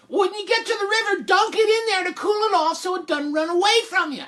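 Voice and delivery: very high, halting mom voice; exasperated mother. Acted. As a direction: strain for patience in a high pitch. Mother instructs Jack.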